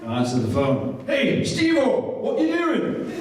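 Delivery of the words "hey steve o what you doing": higher, Welsh-tinged impression of Davey; chirpy mimicry of a mate calling; imitating Davey. Voicing Davey on the phone.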